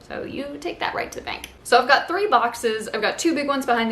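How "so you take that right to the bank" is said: joking whispered aside; playful whisper to the viewers. Acted. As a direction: lean in and whisper it like a secret.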